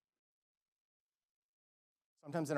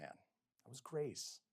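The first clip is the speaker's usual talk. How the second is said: normal talking voice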